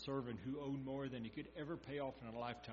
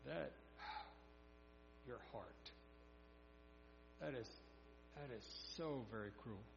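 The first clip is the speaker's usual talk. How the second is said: disappointed master; 7 dB quieter than usual. Retold or imitated. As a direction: sigh before letting him down gently. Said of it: "the master calls him back and says".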